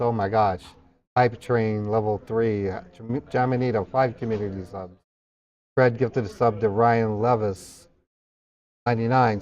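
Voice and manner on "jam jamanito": normal talking voice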